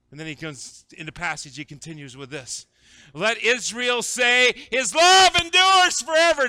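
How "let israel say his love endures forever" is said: loud, high, drawn-out proclamation; preacher's amped-up shout of praise; amped-up psalmist. Acted. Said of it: "this guy is amped now".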